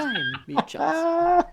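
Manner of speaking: loud, high and very slow; grand slow proclamation. Acted. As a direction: draw it out like a royal herald. Repeats it grandly.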